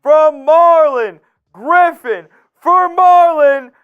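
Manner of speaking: booming slow stadium-announcer call; hype announcer. Real, not acted. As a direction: stretch every word out like a ring announcer. Announcing a first-time super chatter.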